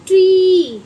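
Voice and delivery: Tree called out loud and high; emphatic word. Shown as a demonstration. Teacher calling out tree for children. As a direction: sing out tree for the class. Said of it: "क्या बनाइए, tree".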